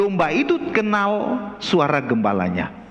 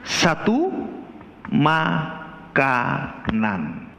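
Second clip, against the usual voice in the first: drawn out; 4 semitones lower, much slower than usual. Acted. Drawn-out sermon emphasis.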